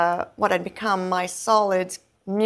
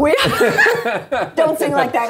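high, louder laughing voice; 6 semitones higher, 6 dB louder, faster than usual. Real, not acted. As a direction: laugh through the line. Laughing in the odd voice.

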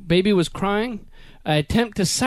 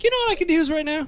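eager thought; 9 semitones higher, faster than usual. Retold or imitated. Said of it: "I was like".

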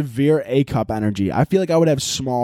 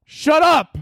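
high, loud panicked snap at the bird; embarrassed yell; 3 dB louder, slower than usual. Retold or imitated. Owner yelling at his swearing bird, high and frantic. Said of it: "and I'm like".

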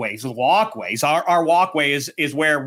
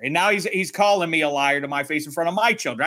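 ordinary talk

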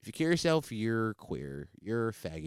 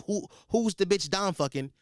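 usual speaking voice

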